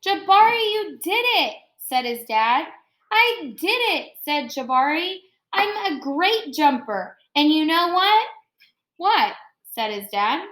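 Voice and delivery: expressive picture-book read-aloud; reading to children. Acted. Teacher reading Jabari Jumps to her class, expressive read-aloud. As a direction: read the story warmly to the class, pausing on each step.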